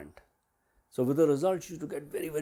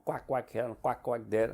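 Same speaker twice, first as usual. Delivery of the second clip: normal talking voice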